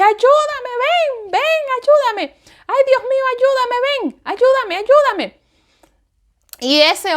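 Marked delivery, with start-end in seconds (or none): 0.0-5.4 high, strained cries for help; high panicked plea to her husband; desperate pleading. Retold or imitated. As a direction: beg him frantically, voice way up. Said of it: "fue cuando más le dije".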